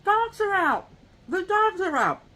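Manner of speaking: put-on high child voice calling out in alarm; little kid yelling. Little kid shouting to the neighbor, high alarmed call. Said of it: "I hear a little kid".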